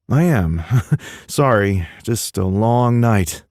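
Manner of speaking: brittle forced laugh. Acted. Miles brushing off Tanner's concern with a laugh. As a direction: laugh it off to hide the pain.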